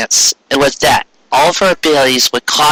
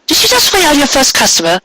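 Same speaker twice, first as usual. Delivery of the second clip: high, gentle mare's voice; Cupcake voice; 13 semitones higher than usual. A narrator's reading. Cupcake asking Pinkie about her first customer, high and gentle. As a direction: ask it like a kindly baker. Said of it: "she asked".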